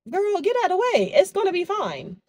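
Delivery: put-on squeaky sassy girlfriend voice; high mock-bossy friend; high teasing voice. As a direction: pitch way up, playful and bossy. High playful quote voice.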